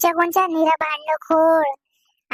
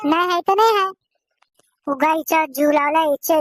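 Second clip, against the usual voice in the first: ordinary talk, nothing marked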